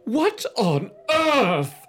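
high, slow shrill mother voice; mother aghast at the mess; hammed-up appalled mom; shocked mother. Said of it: "Missus Powell cried".

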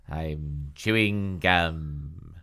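low, very drawn-out apology; sluggish character voice. A narrator's reading. Dina apologizes on the phone, low and drawling. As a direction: drag the words out low.

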